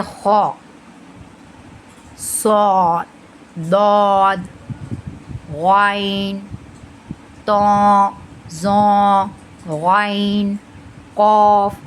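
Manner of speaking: "खौ, सौद, दौद" drawn out with a full, rounded mouth; "गैन, कौफ" sounded heavy and full, one at a time. Shown as a demonstration. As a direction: fill the mouth on each letter, "तौ, जौ" held on a long "o". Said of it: "पूरा मुंह भर के इसे अदा करेंगे".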